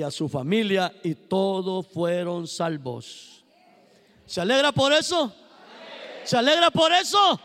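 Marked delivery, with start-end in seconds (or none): 4.2-7.4 rising ever louder and higher to a shout; rallying the congregation. Acted. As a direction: whip the church into joy.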